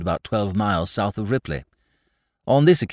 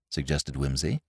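usual speaking voice

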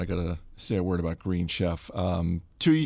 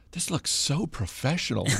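higher-pitched, earnest imitation of a teen's praise; dead serious; 6 semitones higher than usual. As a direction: imitate sincere praise without any sarcasm. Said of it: "she was dead serious, not a touch of sarcasm in her voice".

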